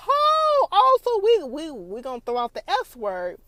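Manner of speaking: very high, loud sassy outburst; sassy exclamation. Retold or imitated. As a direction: blast it out, hammed-up sass. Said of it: "I was like".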